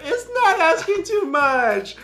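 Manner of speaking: very slow, high, loud mock wail; wailing kid. Imitating the son insisting through tears, slow high wail. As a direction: stretch it out like a crying toddler. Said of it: "He's like".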